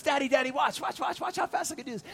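put-on high, eager child calling to Dad; excited little boy. Little son showing off to his daddy, excited high child voice. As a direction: imitate a small son begging to be watched. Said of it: "he just turns around and he goes".

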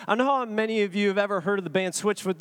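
usual speaking voice

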